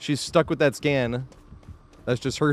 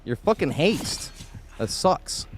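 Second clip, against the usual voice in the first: frustrated outburst; 7 semitones higher, slower than usual. Real, not acted. Streamer cursing at the game, a frustrated shout. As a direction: snap it out, annoyed at losing the chase.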